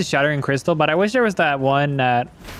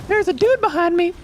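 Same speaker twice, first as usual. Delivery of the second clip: sudden high-pitched startled gasp, then the words squeaked out. Real, not acted. Player spotting an enemy behind him, startled high squeak. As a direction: jump at a figure behind you, voice shooting up. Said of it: "my voice went so high on that".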